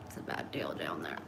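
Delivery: hushed, confiding whisper; barely voiced secret aside. Real, not acted. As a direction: lean in and whisper it low and soft.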